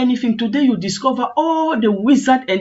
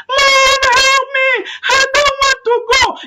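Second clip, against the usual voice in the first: put-on child screaming; 14 semitones higher, 4 dB louder than usual. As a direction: scream like a terrified child, acting it out. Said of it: "The child start screaming".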